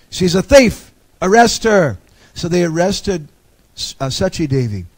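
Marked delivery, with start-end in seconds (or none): 0.1-2.0 loud, high-pitched cry of thief; accusing shout. Retold or imitated. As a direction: shout it out to have her seized. Temple priests accusing intruder.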